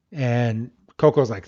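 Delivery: ordinary delivery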